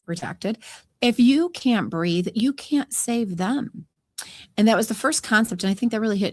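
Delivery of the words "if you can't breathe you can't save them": slowed-down inner voice, each word weighed; slow emphatic realization. Retold or imitated. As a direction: drive the lesson home slowly. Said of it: "But then I realized".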